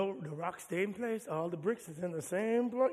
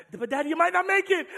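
put-on higher, louder scared kid; child's voice; 11 semitones higher, 11 dB louder than usual. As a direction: play the worried little son. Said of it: "child's voice in acted scene".

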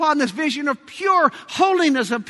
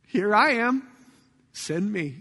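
humble answer; 4 semitones lower, 6 dB quieter than usual. Acted. Isaiah's humble answer to God.

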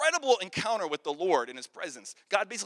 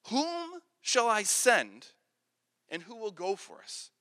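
slow solemn question; slower than usual. Acted. God asks the council.

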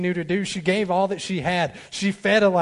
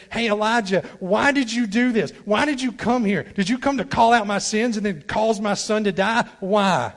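louder and higher, accusing questions; the widow's complaint; 5 semitones higher, 5 dB louder than usual. Retold or imitated. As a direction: confront the prophet with grief. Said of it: "The widow says".

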